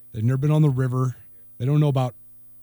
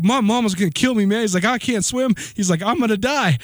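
higher, hammed-up frightened friend; mock panic; 9 semitones higher, 3 dB louder than usual. Storyteller voicing his terrified friend, mock panic. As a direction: play the scared city kid, half-joking.

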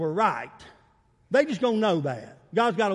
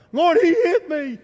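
higher, louder, slower complaint; tattling to the Lord; 7 semitones higher, 8 dB louder than usual. Pretend. Preacher acting a believer telling Jesus on someone, mock complaint. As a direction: whine it up to God like a hurt child.

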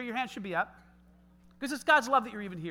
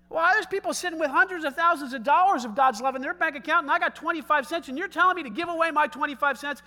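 indignant protest; 4 semitones higher, 7 dB louder than usual. Acted. Acts out objector's indignant protest.

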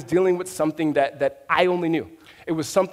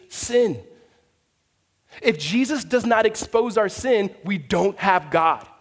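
a passionate sermon climax; preaching at full force; 5 semitones higher, 3 dB louder than usual. Acted. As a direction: press the point home like a pastor.

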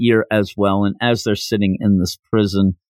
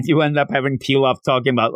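usual speaking voice

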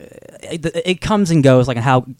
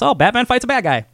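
mocking simple comics; 10 semitones higher than usual. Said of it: "instead of just".

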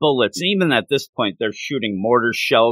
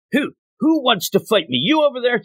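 much higher, hammed-up taunt; mock taunting villain; 11 semitones higher than usual. Wang Chu goading his captives, high hammed-up taunt. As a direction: call out a challenge across the yard.

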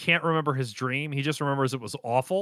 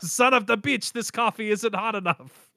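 imitating dub actor; 6 semitones higher than usual.

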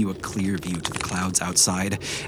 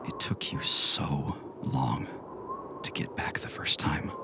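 soft whisper; 3 semitones lower, 6 dB quieter than usual. A narrator's reading. Somber narration of falling.

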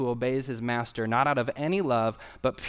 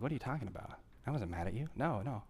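soft reassuring friend; 11 dB quieter, faster than usual. Retold or imitated. Friend reassuring, wasn't mad.

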